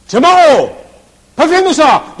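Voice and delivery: high, full-force shout; thundering rebuke, very loud; screamed command. Acted. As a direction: scream an order at the storm. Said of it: "And he screamed at them".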